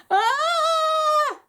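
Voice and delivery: high, loud panicked shriek; high, loud goblin squeal; goblin scream. Acted. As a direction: shriek in terror at a human. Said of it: "Grogbar screamed".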